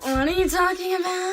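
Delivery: high, loud feigned cluelessness; playing dumb. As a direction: act like you have no idea. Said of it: "me playing dumb".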